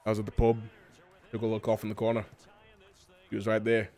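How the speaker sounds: put-on pub tale in a Scottish accent; put-on Scottish storyteller. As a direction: tell it as a Scotsman. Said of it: "Bald and Scottish".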